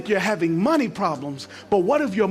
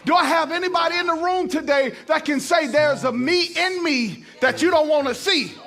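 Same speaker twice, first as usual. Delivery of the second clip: full-force preaching; 6 semitones higher than usual. Acted.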